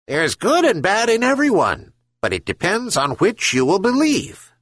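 high, wise gull voice; herring gull. A narrator's reading. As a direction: speak up high like a patient seabird. Gull answers old man.

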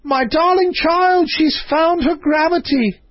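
high, drawn-out cry of delight; delighted scream. A narrator's reading. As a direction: scream it out in joy, high and far from your own voice.

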